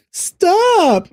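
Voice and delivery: put-on drawn-out, high-pitched child's protest; flustered kid. Dad imitating his kids objecting to accents, flustered whine. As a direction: wail it like a fed-up small kid, imitating. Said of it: "they get so flustered".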